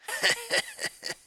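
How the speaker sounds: high giggle. A narrator's reading. Character laugh after taunt.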